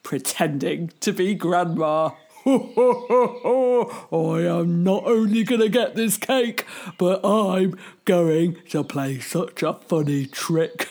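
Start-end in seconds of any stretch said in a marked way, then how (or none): 2.3-10.9 cheeky wolf. Acted. Wolf gloating in disguise.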